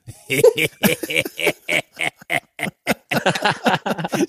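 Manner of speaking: long rising mock giggle; imitated laugh. Imitating the old lady's embarrassed laugh at him, mildly. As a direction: copy her embarrassed laugh. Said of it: "she started laughing like that".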